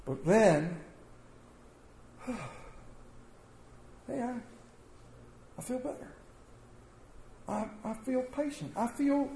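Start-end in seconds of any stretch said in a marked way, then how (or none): 2.2-6.1 soft, higher, sighing relief; relieved sigh. Acted. Forgiver tells the congregation how it feels afterward, soft relief. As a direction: exhale and sound lighter inside.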